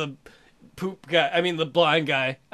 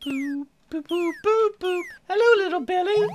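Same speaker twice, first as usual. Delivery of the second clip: high cartoon taunting voice; poop character taunt; 12 semitones higher than usual. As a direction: tease the kid in a high squeak.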